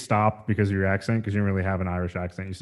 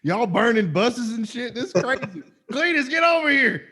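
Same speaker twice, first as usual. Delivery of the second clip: laughing Southern caricature; 12 semitones higher than usual. Pretend. Mock redneck tourist bit.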